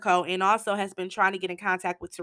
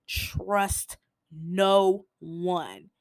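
slow, every word of Trust no one leaned on; stressed warning; much slower than usual. Retold or imitated. Lawyer warning Tariq, slow stressed Trust no one. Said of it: "He stresses that".

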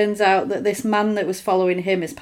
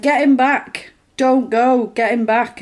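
higher, louder retold command; imitated urgent plea; 4 semitones higher, 5 dB louder than usual. Blind sister begging for him to return, imitated urgency. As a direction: act out a pressing plea.

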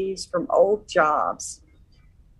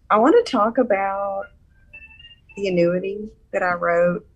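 ordinary delivery

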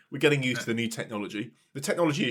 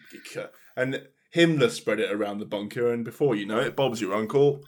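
normal talking voice

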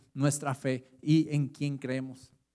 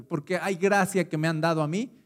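ordinary talk

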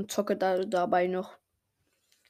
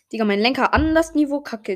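loud, higher angry rant; frustrated outburst; 5 semitones higher, 8 dB louder than usual. Real, not acted. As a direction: vent at the bad steering. Frustration with controls. (lauter, genervter Ausbruch)

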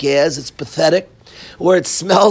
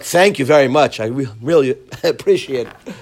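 mock polite thanks; 8 semitones lower than usual. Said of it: "It's very hard to be very".

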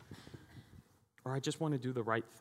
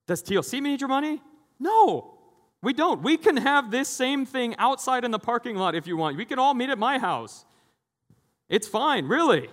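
high, loud emphatic insistence; 10 semitones higher, 9 dB louder than usual. Real, not acted. Pastor telling the congregation the church doesn't need their money, loud and high. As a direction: preach it with full force.

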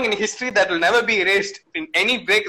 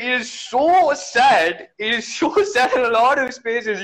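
drawn-out sad; 5 semitones higher, much slower than usual. Pretend. Mock lament over sad song.

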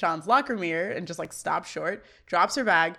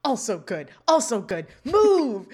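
mock-aggressive [name]; 5 dB louder, slower than usual. [name] roughs up his own hair, mock-aggressive, building. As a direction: escalate into an exasperated, hammed-up order. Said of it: "[name] then starts aggressively trying to".

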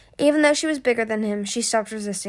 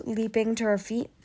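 ordinary delivery, nothing marked

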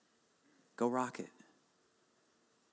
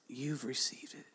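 whispered voice of God; a soft, whispered divine instruction; 2 semitones higher, 7 dB quieter than usual. Retold or imitated. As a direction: whisper God's command gently. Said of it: "He said".

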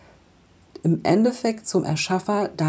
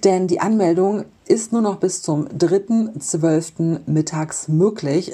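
ordinary delivery

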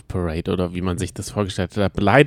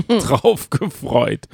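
laughing; 8 semitones higher, 3 dB louder, much slower than usual. Real, not acted. Excited memory of Scooter concert.

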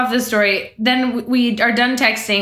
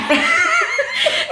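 high laugh. Real, not acted. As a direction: burst of high-pitched laughter. Laughing at the texts.

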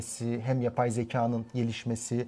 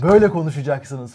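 mock scolding; 2 semitones higher, 10 dB louder than usual. Mocking purists demanding Turkish.

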